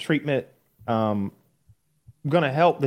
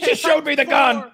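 put-on scared kid voice; 8 semitones higher, 3 dB louder than usual. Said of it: "And they go".

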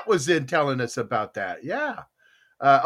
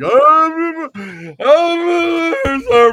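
loud mock drunken sobbing ah ah; drunk crying wails; 11 semitones higher, 9 dB louder, much slower than usual. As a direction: wail like a weepy drunk. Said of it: "I'm gonna be like".

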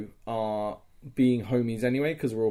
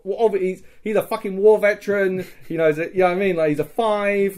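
excited; 8 semitones higher, 8 dB louder, faster than usual. Real, not acted. Excited rant about war hero.

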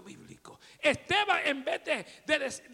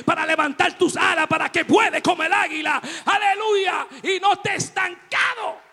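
full-force preaching; 7 dB louder than usual. Real, not acted. Preacher shouting to his church, full force. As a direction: preach it out to the congregation.

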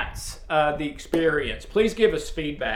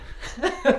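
breathy chuckle; soft laugh; 7 semitones higher, 4 dB quieter than usual. Real, not acted. Speaker laughing at the memory, soft chuckle. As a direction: laugh it off lightly.

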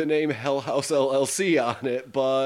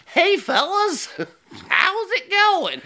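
much higher, louder, slow goofy greeting; silly high voice; 5 dB louder, slower than usual. Acted. Co-host greeting the guys in a goofy high put-on voice. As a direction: chirp it like a cartoon character.

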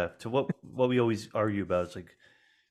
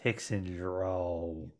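Texan drawl; 3 semitones lower, 6 dB quieter, much slower than usual. Shown as a demonstration. Describing the McConaughey voice while slowly demonstrating the drawl. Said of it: "deep Texan".

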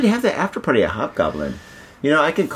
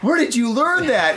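shocked parent; 13 semitones higher, slower than usual. Retold or imitated. Parent hearing his daughter swear, high and startled. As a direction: sound caught off guard by your child. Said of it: "You're like".

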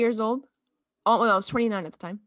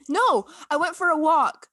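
louder, higher burst of remembering; sudden recollection; 6 semitones higher, 7 dB louder than usual. Real, not acted. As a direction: blurt it out as it comes back to you. Suddenly remembering what she did.

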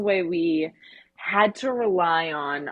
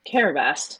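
normal talking voice

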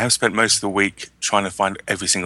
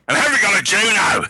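high-pitched, raised shout; jokey loud call; 13 semitones higher, 4 dB louder than usual. Real, not acted. Co-host jokingly hustles the show along, loud call.

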